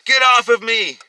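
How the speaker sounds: very high, loud scream; woman's high, loud cry of protest. A narrator's reading. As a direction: scream to fight off an attacker. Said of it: "he heard her screaming".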